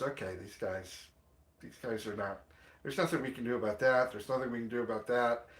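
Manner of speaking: lightly voiced officer, dropped low and quiet; dismissive law enforcement. Law enforcement looks at the fanatics' claims, quietly dismissive. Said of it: "law enforcement looks at that and goes".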